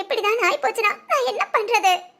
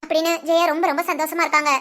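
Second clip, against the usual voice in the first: low, steady storyteller's voice; story narration; 4 semitones lower than usual. A narrator's reading. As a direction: narrate the twist to the viewers.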